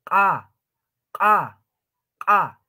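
"Ka" as q'a, high and popping; ejective Q. Shown as a demonstration. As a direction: burst "Ka" like something exploding. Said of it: "haciendo reventar o explotar algo". (q'a eyectiva, como reventando)

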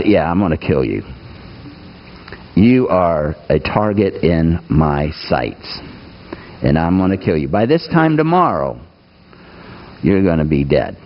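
a hint of a cold queen promising death; menacing Jezebel. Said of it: "And Jezebel decides".

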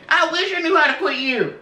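high hammy country twang; country accent. Shown as a demonstration. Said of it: "put in a country accent for you".

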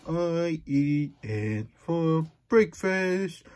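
slow, drawn-out silly reading; silly book voice. Acted. Reading the silly book aloud to a kid, slowly hammed. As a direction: ham up the silly book lines.

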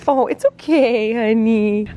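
slow, gentle reassurance; soothing. Real, not acted. Comforting scared puppy.

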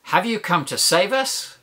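lifted, questioning cry ending upward; hopeful cry. Acted. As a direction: ask it as a cry for rescue. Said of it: "Imagine the man crying".

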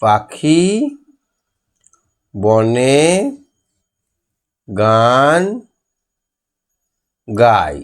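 word by word with long gaps; slow dictation. Shown as a demonstration. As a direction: dictate so children can write each word. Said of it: "কি লিখবো?". (ধীরে ধীরে শ্রুতলিখন)

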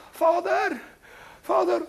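hoger kinderstemmetje; kinderstem roept. Acted. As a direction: roep als een klein kind. Kind roept vader. (kindje roept naar vader)

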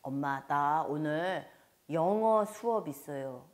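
put-on kid telling mom, slowly; child to mother. Child telling mother about English class, slow put-on child voice. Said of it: "그랬더니 아이가".

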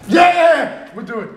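high, loud mock enthusiasm; hyped-up reply. Acting out a reply mid-match, mock-excited. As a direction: burst out with an exaggerated loud agreement.

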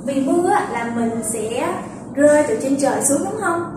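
normal talking voice

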